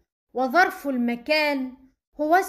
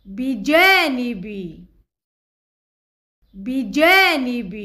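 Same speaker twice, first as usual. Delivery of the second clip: بجانب drawn out slowly and said louder; stressed, slow word; 3 semitones lower, 5 dB louder than usual. Shown as a demonstration. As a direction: say بجانب slowly and firmly, twice. Teacher stressing new word. (بجانب ببطء وبقوة)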